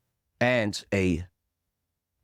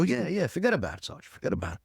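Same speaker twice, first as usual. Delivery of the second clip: a light New York "forget about it", higher, to a sergeant; New York accent; 9 semitones higher, faster than usual. Retold or imitated. To his sergeant, a hint of a New York accent. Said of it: "Really talk like that?".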